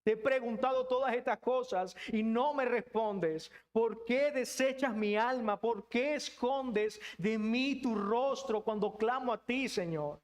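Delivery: slow, drawn-out cry to God; pleading questions. Acted. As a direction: plead as the unanswered psalmist, stretching the words. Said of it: "continúa preguntando y le dice".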